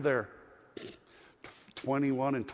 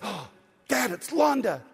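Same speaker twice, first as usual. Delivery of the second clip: put-on excited child; 5 semitones higher than usual. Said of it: "And he said".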